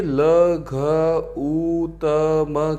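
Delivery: ल, घ, ऊ, त, म each drawn out as a separate sound. Shown as a demonstration. Teacher breaking लघुतम into its sounds for students, drawn out sound by sound. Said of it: "इसका संधि विच्छेद करूँ".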